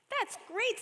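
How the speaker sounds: high, bright little exclamation; piping up. A narrator's reading. Sammy interrupting his father, piping up high. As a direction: pipe up eagerly. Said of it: "Sammy piped up".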